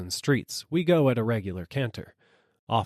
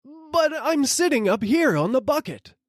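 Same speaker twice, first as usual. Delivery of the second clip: much higher, loud desperate cry; 5 dB louder than usual. A narrator's reading. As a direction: cry out to be seen. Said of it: "I cry".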